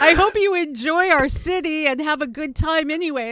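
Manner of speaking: high, soft, polite Canadian impression; nice Canadian. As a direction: imitate a local's kind well-wishing, amused. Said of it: "some really nice Canadian was coming up".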